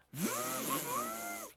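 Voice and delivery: high, sustained engine whine; imitated jet ski. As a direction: mimic a jet ski at full throttle. Imitates the jet ski going fast.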